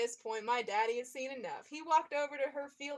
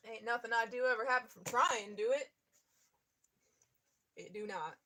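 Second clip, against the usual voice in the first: mock Southern accent; 4 dB louder than usual. Reader jokingly trying on a Southern accent, laughing. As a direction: put on a playful fake Southern accent. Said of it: "how to have a Southern accent".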